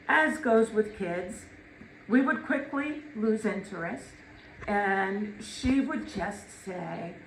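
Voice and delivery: ordinary talk, nothing marked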